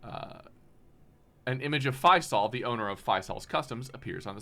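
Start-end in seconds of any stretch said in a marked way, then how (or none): none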